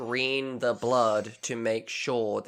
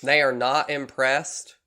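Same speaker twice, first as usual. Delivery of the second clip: slow, louder southern drawl; southern accent; 3 semitones higher, 6 dB louder, slower than usual. Acted. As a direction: read it as a southern lab director. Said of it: "how I did with that accent".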